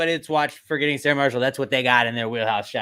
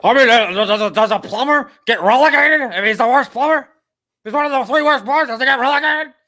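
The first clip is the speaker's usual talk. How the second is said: high, loud ranting talk-radio host; Rush Limbaugh rant; 10 semitones higher, 3 dB louder than usual. Acted. Mocking the pundit, ranting about relegated plumbers. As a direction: rant like a talk-show blowhard. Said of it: "I just went into Rush Limbaugh there".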